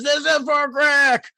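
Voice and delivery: high, loud hobgoblin war cry, drawn out; vengeful battle cry. Acted. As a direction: charge in yelling for a fallen comrade. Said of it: "charges Osgood and says".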